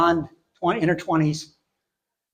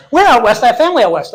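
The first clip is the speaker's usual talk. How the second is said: higher and louder, worried asking; anxious urgent question; 8 semitones higher, 9 dB louder, faster than usual. Retold or imitated. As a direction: press him anxiously for details. Cashier anxiously asks.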